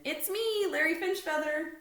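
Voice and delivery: put-on high, loud kid voice; Larry calling in. A narrator's reading. Larry calling into the bathroom to Stanley, high and loud. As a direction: call out brightly to announce yourself.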